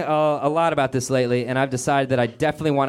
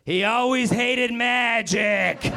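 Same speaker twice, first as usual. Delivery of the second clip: higher, very slow deadpan sendoff with a laugh; creepy magician; 9 semitones higher, much slower than usual. Retold or imitated. Magician addressing the shocked mourners, slow creepy deadpan. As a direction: drag it out like a sinister showman.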